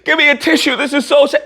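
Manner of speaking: high, hammed-up fake sobbing, asking for a tissue; mock sobbing president. Mocking the president as if he were crying over the video. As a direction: ham up a weepy breakdown. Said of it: "was going".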